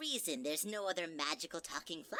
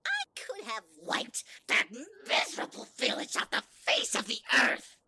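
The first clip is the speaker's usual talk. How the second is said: menacing; 7 dB louder than usual. Acted. Flowey's menace surfaces.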